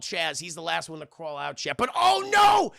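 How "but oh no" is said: high, loud theatrical cry of dismay; mock alarm. Storyteller warning chat of disaster, hammed-up loud alarm. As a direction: announce disaster with hammed-up panic. Dramatic turn in the retelling.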